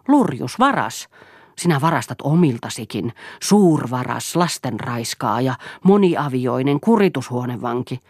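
furious wife insulting her husband; hurled insults. Acted. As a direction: spit every insult at him. Siiri hurls insults at Eero.

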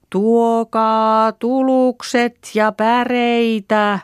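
very high, loud, slow sustained call; long chanted holler, every vowel held; hollering in unison. A narrator's reading. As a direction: call through cupped hands to far-off home. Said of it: "pannaanhan kätemme äänen kuurroksi".

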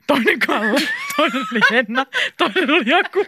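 high, laughing list; giggling. Real, not acted. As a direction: rattle off names through giggles. Guest list turns giggly, escalating.

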